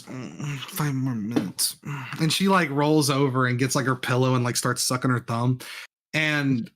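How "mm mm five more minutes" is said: soft, slow, half-asleep mumble; mock sleepy five more minutes. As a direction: groan it like you won't get up. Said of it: "the lady goes".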